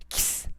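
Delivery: a soft, drawn "ks" showing the x of táxi; ks sound demo. Shown as a demonstration. Teacher sounding out "ks" for the class, soft and clear. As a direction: breathe out a quiet "ks" as the two sounds of X. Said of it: "ele tem um som de K S".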